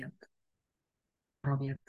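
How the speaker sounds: slow, breathless whisper of amazement; awed whisper. Acted. Teacher marvels at seeing God, in a slow whisper. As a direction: whisper it as if the idea overwhelms you.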